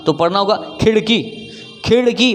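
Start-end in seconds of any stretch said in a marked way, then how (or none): 0.8-1.5 खिड़की as "khiṛki", with a retroflex flap; flapped ड़. Shown as a demonstration. Teacher models खिड़की for learners, flapped ṛ. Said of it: "झटका दे करके हमें निकालना है". 1.9-2.4 खिड़की as "khiṛki", with a retroflex flap; flapped ड़. Shown as a demonstration. Teacher models खिड़की for learners, flapped ṛ. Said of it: "मैं र बोल रहा हूँ क्या?".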